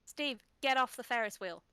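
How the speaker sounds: high, loud telling-off; calling out. Acted. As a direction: scold a runaway pet loudly. Calling to misbehaving pet salamander.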